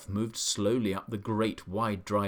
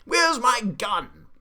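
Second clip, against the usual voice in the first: high, very loud, outraged bellowing; Duke's furious shouting; 10 semitones higher, 9 dB louder than usual. A narrator's reading. Duke to bird stealing his cherries, furious shouting. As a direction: rant at full volume, apoplectic with indignation. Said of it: "the Duke was shouting".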